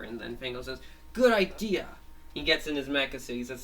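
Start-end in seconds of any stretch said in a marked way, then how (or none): 1.1-1.9 high, loud cartoon approval; keen agreement. Fango welcomes Hacker's plan, keen agreement. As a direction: jump on it, pitched up and punchy.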